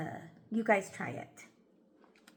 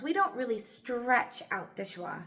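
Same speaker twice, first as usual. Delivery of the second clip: ordinary delivery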